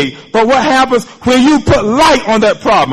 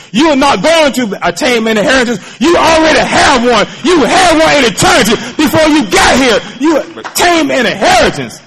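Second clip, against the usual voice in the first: full-force preaching; 3 semitones higher than usual. Acted. Preacher assuring the congregation of their inheritance, full force. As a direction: proclaim it to the church.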